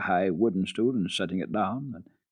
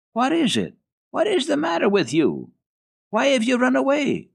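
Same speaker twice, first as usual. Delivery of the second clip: sharp, high, loud demand; shrill governess demanding answers; 6 dB louder than usual. Acted. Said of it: "demanded Fraulein Rottenmeier".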